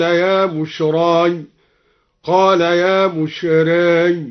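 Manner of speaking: slow chant, وجاءت سيارة merged as "wajaas-sayyara"; assimilated taa. Shown as a demonstration. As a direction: recite slowly, t melting into s, بشراي ending in ya. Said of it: "بإدغام التاء في السين". (تلاوة مرتلة بإدغام تاء وجاءت في السين)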